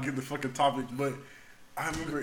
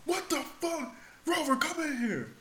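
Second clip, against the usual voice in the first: retold outraged summons; 14 semitones higher than usual. Superior summons the rover to the bathroom, retold outraged call. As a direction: call him in with high mock indignation.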